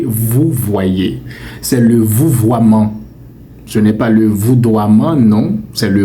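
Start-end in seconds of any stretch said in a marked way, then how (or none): 0.0-3.0 vous voyez and vouvoiement said very slowly with "vou-vwa"; correct model. Shown as a demonstration. (vouvoiement très lent, « vou-vwa-man »)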